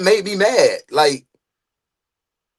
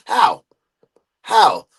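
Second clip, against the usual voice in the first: usual speaking voice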